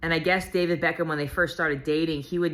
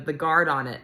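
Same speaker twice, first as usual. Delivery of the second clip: ordinary talk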